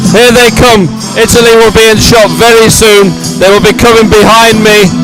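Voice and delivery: ordinary delivery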